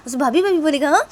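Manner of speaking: high, loud sarcastic jab; mocking. Sister mocking brother who wants a bhabhi. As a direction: throw his own words back at him.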